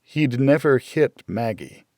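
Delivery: ordinary delivery, nothing marked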